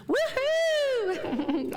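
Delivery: cheer. Real, not acted. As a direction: lead the room in a big laughing whoop. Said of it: "So everybody with me".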